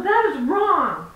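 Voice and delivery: repeating the rebuke to the stranger; indignant repeat. Retold or imitated. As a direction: hammer it home slowly. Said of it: "I go".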